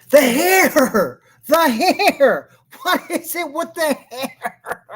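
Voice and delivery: high, loud laughing exclamations. Real, not acted. Host reacting to the guest's hair, laughing loudly. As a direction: crack up at the hair.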